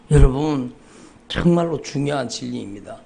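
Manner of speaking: ordinary talk, nothing marked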